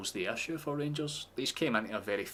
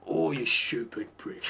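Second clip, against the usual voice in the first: slow, whispered mock Danny Dyer cockney insult; muttering a cockney telling-off like Danny Dyer; 3 semitones higher, 4 dB louder, slower than usual. Coach berating his non-league players, whispered Danny Dyer cockney. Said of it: "Just shout at them in that accent".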